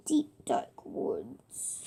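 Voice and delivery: slow, hushed whisper; spooky whisper. Acted. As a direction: breathe it out like a spooky secret. Spooky woods in the story.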